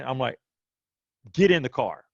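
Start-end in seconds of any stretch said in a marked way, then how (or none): 1.3-2.1 louder, higher retold command; urgent order. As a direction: order them into the car, urgently. Father orders wife into the car.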